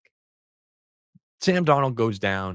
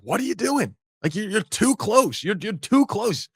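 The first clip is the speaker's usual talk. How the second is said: much higher, imitated exasperated coach yelling; imitating Macdonald losing it; 11 semitones higher than usual. Coach losing it at a player too close to the quarterback, imitated yell. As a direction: mimic a coach who has lost his patience. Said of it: "he just kinda loses it".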